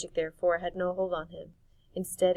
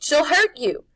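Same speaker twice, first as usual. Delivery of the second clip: high, loud urgent plea; frightened warning; 9 semitones higher, 15 dB louder than usual. Acted. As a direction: warn him in fear. Griffin warns Harvey.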